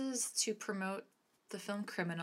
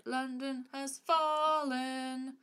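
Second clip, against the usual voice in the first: dramatic title read; 7 semitones higher, 4 dB louder, much slower than usual. Acted. Announcing favourite film title.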